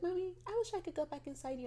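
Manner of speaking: put-on high, small child voice; three-year-old daughter. Three-year-old daughter confiding in mom, small child voice. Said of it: "She's like".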